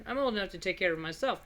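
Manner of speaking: ordinary talk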